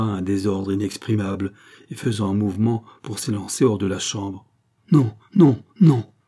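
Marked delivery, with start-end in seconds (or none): 4.9-6.1 much louder, higher, desperate; frantic refusal. Acted. The countess refusing Gilbert in panic, frantic cries. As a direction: cry out in panic. André panics, trying to flee.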